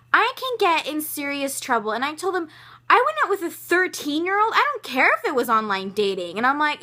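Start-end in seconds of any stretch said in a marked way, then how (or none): 0.1-1.9 high-pitched, indignant retelling; retold anger. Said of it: "I was completely over the moon pissed". 2.9-6.4 high, loud indignant outburst; retold anger. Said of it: "I was completely over the moon pissed".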